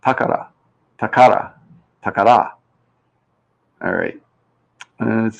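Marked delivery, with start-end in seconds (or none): 0.0-0.5 Takara with the first syllable TA hit hard; stress on first syllable. Shown as a demonstration. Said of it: "We can put the stress anywhere". 1.0-1.5 Takara with the middle KA stressed; stress on middle syllable. Shown as a demonstration. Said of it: "We can put the stress anywhere". 2.0-2.5 Takara with the final RA stressed; stress on last syllable. Shown as a demonstration. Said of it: "We can put the stress anywhere".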